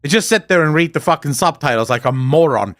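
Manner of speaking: normal talking voice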